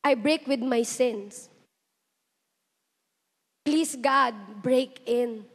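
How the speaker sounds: quiet prayer. Acted. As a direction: pray it softly, in surrender. Leads a prayer.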